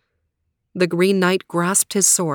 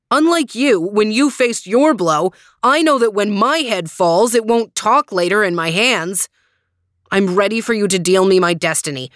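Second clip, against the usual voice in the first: hotly; 3 dB louder than usual. Acted. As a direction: answer the taunt hotly. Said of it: "said Gawain hotly".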